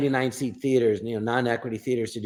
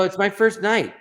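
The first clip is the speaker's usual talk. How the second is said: higher-pitched, exasperated insistence; insistent; 6 semitones higher, 3 dB louder than usual. Retold or imitated. Retold exchange, insisting after repeated disbelief.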